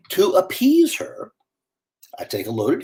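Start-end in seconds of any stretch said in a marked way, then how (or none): none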